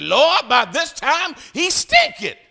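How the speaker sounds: high, slow complaining Martha; Martha's protest. Retold or imitated. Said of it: "Guess what she said?".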